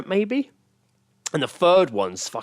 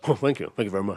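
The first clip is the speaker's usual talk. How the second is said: [name] catchphrase; 3 semitones lower, 3 dB quieter, faster than usual. Retold or imitated. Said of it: "he was like".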